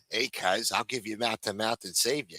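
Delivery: a hint of a haggard bartender; haggard Staten Island barmaid. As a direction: offer it like a worn-out bartender. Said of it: "haggard Staten Island woman bartenders".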